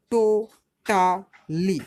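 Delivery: "টো টা লি" in three separate held beats; split into syllables. Shown as a demonstration. Teacher showing three tongue moves, "টো ... টা ... লি" split. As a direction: pause after each syllable of "টো টা লি". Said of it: "জিভের গতিটা তিনবার বদলায়".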